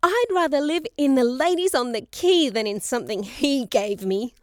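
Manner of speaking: loud, high-pitched outburst of hurt; impassioned yell. A narrator's reading. Daughter refusing father's gift of a home, passionate yelling. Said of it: "she yelled in her passion and grief".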